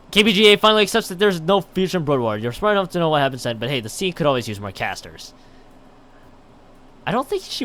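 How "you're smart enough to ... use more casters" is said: ordinary talk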